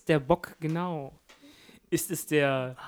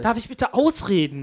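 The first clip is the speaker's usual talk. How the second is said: mock debate indignation; 5 semitones higher, 3 dB louder than usual. Mock talk-show quarrel.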